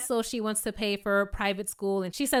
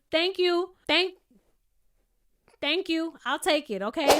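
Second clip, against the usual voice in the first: mock greedy thanks; 7 semitones higher than usual. Mock eager investor acceptance.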